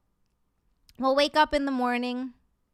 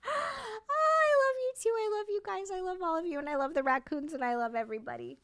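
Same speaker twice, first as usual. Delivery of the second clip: very high, squeaky voice gradually sliding down; silly baby voice; 7 semitones higher than usual. Pretend. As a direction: gush in a tiny high voice, easing back toward normal. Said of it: "I don't know why I'm talking like that".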